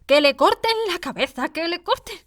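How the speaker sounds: high, loud, raging royal command; furious yelling. A narrator's reading. Queen screaming at Alice in fury. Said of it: "empezó a gritar".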